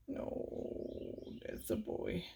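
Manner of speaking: soft whisper to the cat. Real, not acted. Whispering fond praise to the cat. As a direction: breathe the praise, no voice.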